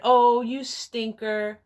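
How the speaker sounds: slow, high mock-teasing "Oh, you stinker". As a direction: drag it out like a coy tease. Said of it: "and said".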